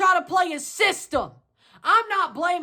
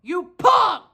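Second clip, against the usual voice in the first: shouted insult; 2 semitones higher, 3 dB louder than usual. Real, not acted.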